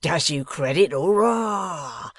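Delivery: loud, rising rustic crow of approval; cheering. A narrator's reading. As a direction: cheer him on, voice soaring on Hoorah. Riderhood cheers the other's curse.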